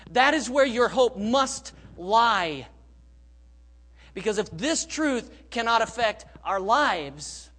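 emphatic, impassioned preacher in full voice; forceful preaching. Real, not acted. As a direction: drive each point home loudly to the congregation.

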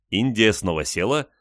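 usual speaking voice

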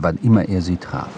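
ordinary talk, nothing marked

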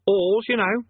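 put-on high boy voice, putting up with fools; long-suffering patience. A narrator's reading. Boy Eric repeating himself to Rincewind, long-suffering. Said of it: "sigh of long-suffering patience".

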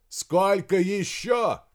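high, loud, drawn-out shout. A narrator's reading. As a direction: call out the question slowly and loudly. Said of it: "прокричал". (громкий протяжный крик)

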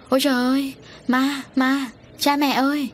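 high-pitched narrated cry of fright; frightened cry. A narrator's reading. Girl seeing what she takes for a ghost, acted frightened cry. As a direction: cry out in alarm, pitched well up, without full screaming. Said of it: "nghe tiếng Hồng Hạnh hét".

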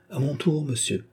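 high start sinking away, troubled; a touch of unease; timid request, dropping off at the end. A narrator's reading. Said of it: "toute troublée".

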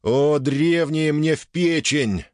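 hearty exclamation. Acted. Exuberant exclamation.

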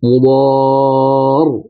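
مضار with a long, stretched a; مضار held long like a recitation; drawn-out example. Shown as a demonstration. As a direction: slowly lengthen مضار. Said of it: "نحو".

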